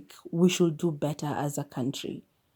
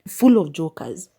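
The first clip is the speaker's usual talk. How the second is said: exasperated outburst; 6 dB louder than usual. Retold or imitated. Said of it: "I was like".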